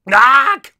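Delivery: loud, high startled scream. A narrator's reading. Buster seeing a ghost appear, screaming. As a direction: shriek as if falling back. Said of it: "Buster screamed".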